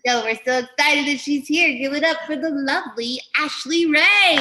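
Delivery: high, loud emcee build-up; rousing stage introduction. Real, not acted. Host bringing on the next comedian, rousing introduction. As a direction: whip up applause for the next act.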